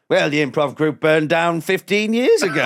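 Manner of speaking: high, loud hammed-up horror reveal; ominous revelation. Sweeper revealing the old fire to comic, ominous. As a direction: deliver it like a mock horror twist.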